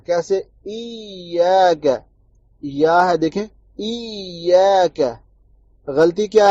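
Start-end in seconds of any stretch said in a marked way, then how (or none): 0.6-1.9 ایاک drawn out slowly with a pure ya sound; correct pure ya. Shown as a demonstration. Teacher demonstrating the correct recitation, slow and careful. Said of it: "pure یا نکالنا ہے". 3.8-5.0 ایاک drawn out slowly with a pure ya sound; correct pure ya. Shown as a demonstration. Teacher demonstrating the correct recitation, slow and careful. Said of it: "pure یا نکالنا ہے".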